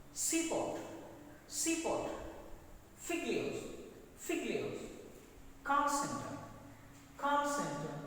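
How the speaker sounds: first half of each compound punched high, second falling away; teacher's model of front-stressed Bookshop, Seasick, Seaport, Fig leaves, Call center; stress on first syllable. Shown as a demonstration. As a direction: drill each word with extra force on BOOK, SEA, FIG, CALL. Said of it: "First one, first syllable".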